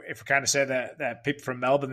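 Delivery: ordinary talk, nothing marked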